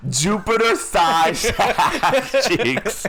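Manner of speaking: slow, laughing through the words. Real, not acted. Storyteller laughing through the punchline to friends. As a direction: crack up while telling it.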